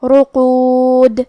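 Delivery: رقود slow, with the final د bounced in a qalqala echo; qalqala on dal. Shown as a demonstration. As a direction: stop on رقود letting the د bounce. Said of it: "دال کو ہلاتے ہوئے یہ کلمہ پہ رکیں گے". (رقود میں دال پر قلقلہ)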